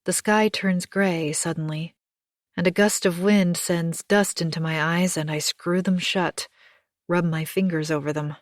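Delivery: ordinary delivery, nothing marked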